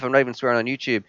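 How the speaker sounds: ordinary talk, nothing marked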